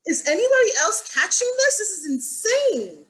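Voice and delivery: high, loud, astonished, with the last words slowed; incredulous astonishment. Retold or imitated. Teacher marvelling at her students' names, high astonished outburst. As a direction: play up your disbelief at the names. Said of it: "I'm like".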